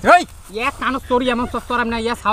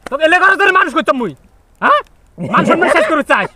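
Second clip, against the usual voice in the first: angry scolding; 4 semitones higher, 4 dB louder than usual. Acted. Uncle scolds the boy for smoking ganja.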